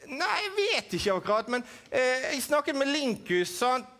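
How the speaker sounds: high puppet voice, matter-of-fact; puppet Edvin. Acted. Puppet Edvin telling the host why he holds the apple, high voice. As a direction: explain it plainly as the puppet.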